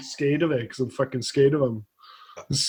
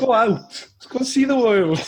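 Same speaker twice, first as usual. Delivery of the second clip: 1 urging spider; 8 semitones higher than usual. Retold or imitated. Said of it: "and you think".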